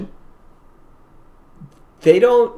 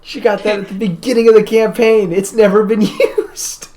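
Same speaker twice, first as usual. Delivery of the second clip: high-pitched amazed exclamation, breaking into a laugh; excited disbelief; 8 semitones higher, 3 dB louder than usual. Real, not acted. Telling the table the item was never used, excited disbelief. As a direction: blurt it out high, laughing near the end.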